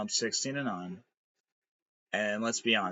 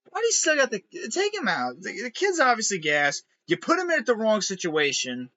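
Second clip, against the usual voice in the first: frustrated rant; 10 semitones higher, 4 dB louder than usual. Real, not acted. Fan berating the manager.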